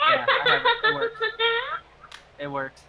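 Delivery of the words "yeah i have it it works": squeaky-high and loud; high-pitched test voice. Shown as a demonstration. Testing a voice changer for friends, very high and loud. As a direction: push the voice far up. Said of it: "Try to go a little higher".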